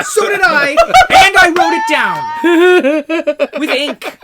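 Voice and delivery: high, loud retort through laughter; laughing shout. Real, not acted. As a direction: outdo the rival while cracking up. Laughing argument over who chose first.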